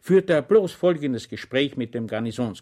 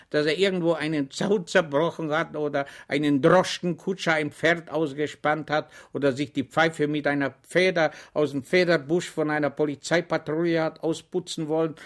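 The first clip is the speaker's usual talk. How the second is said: ordinary delivery, nothing marked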